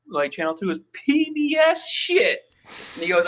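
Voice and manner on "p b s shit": much higher, slow and strained put-on voice; imitating the scary-looking homeowner grumbling; ratty homeowner voice. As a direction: squeeze it out high like a grizzled guy. Voicing the ratty guy at door.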